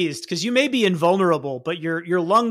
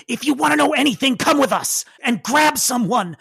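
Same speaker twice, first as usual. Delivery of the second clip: higher, hurried, urgent command; urgent cat woman; 5 semitones higher than usual. Acted. Cat woman to hero while fleeing, urgent and high. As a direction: order them along in a hurried cat-lady voice. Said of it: "the bodies and goes".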